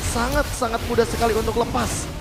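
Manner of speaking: usual speaking voice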